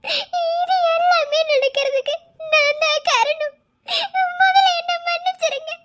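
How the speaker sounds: very slow, crying self-blame; weeping it's all my fault; tearful confession. Acted. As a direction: choke out the guilt through tears. Sister blames herself, crying.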